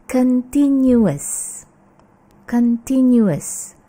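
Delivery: CONTINUOUS drawn out, con-TIN-yu-us; correct continuous. Shown as a demonstration. Teacher repeats it for students, careful pronunciation. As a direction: slowly give CONTINUOUS all four syllables. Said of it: "Bagaimana mengucapkannya?".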